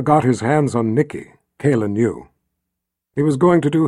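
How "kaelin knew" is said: ordinary delivery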